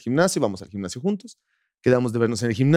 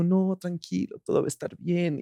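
much softer, gently reassuring; calm the crying person down softly; soothing; 3 semitones higher, 7 dB quieter than usual. Retold or imitated. Boyfriend softly comforts her while she cries. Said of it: "me dijo".

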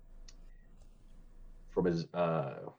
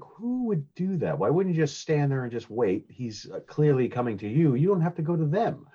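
baffled question; 6 semitones higher than usual. Retold or imitated. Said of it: "I'm like".

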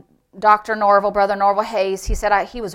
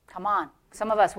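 ordinary talk

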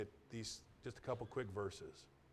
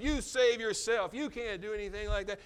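a preacher voicing the mockers at the cross; mocking shout; 8 semitones higher, 8 dB louder than usual. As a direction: jeer it down at him. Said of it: "More heaped up".

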